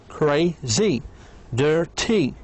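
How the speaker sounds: "crazy" as cra-zy, "dirty" as dir-ty; split into two syllables. Shown as a demonstration. Teacher lists two-syllable adjectives, each broken in two. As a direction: pause between the halves of "crazy" and "dirty". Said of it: "من مقطعين".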